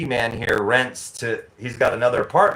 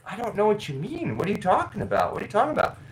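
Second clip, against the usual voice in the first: confused clerk; 8 semitones higher, 5 dB quieter, faster than usual. Retold or imitated. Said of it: "she's like".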